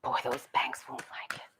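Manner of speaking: hushed whispered aside; soft conspiratorial whisper. Real, not acted. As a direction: breathe it out like a secret. Aside after the reading.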